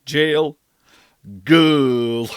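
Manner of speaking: put-on "Jail," English then "girl." as kul, loud; word contrast. Shown as a demonstration. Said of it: "radically different".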